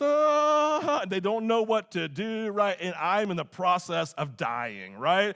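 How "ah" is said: put-on kids panicking. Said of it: "They're like this".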